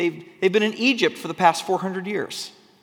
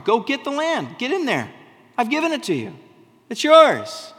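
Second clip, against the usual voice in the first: eager divine command; 6 semitones higher, 4 dB louder than usual. Acted. God urging Israelites to enter.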